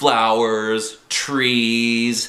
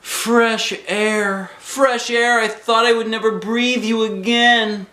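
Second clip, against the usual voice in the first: high, loud gushing shout; excited kid; 13 semitones higher, 5 dB louder, slower than usual. Acted. Junie B leaps off the bus, high and loud with joy. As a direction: gulp it in like you nearly suffocated.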